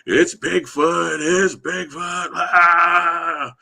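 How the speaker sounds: high, slow, drawn-out panicked yell; retold terrified scream. Man in the field screaming that he saw Bigfoot, high panicked yell. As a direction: shriek it like you just saw Bigfoot. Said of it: "he just screams out".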